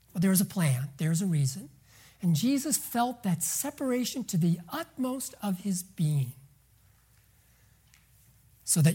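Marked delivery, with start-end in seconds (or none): none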